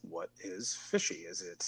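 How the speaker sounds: normal talking voice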